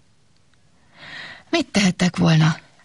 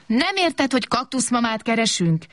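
put-on higher, cross child's cry; angry shout; 7 semitones higher than usual. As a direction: snap at the swallow in annoyance. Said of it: "kiáltott Kobak mérgében". (mérges, magas kiáltás)

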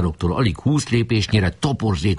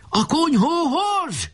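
very high, drawn-out yell; howled command; slower than usual. A narrator's reading. Enemy chief redirecting his troop to the hut, howling. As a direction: stretch it into a high rallying howl. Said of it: "üvöltött Ács Feri".

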